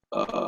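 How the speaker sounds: very low, soft creaky "uh"; vocal crackle. Shown as a demonstration. Singing teacher telling students to try it, low vocal crackle. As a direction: sustain a little fry crackle. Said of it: "like a little crackle on the voice".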